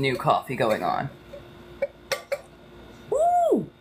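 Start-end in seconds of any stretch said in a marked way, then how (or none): 3.1-3.7 very high whooping cheer; excited woo. Real, not acted. Cheering fresh coffee, a high excited whoop.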